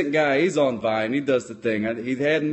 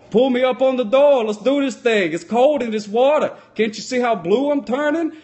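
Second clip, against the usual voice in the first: higher, louder hammed-up Jack pleading from the water; mock Jack; 8 semitones higher, 5 dB louder than usual. Freezing Jack begging Rose for room on the door, higher and louder. Jack voiced retelling Titanic.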